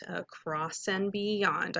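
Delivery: teacher leading the class in unison; slow choral model. Shown as a demonstration. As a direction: say it slowly so the class can echo. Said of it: "do that with me".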